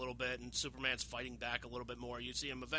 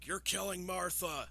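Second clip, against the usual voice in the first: high, slow strained cry; pleading Superman; 7 semitones higher, 3 dB louder, slower than usual. Retold or imitated. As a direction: plead for a life, drawing it out. Acts Superman pleading under Batman's spear.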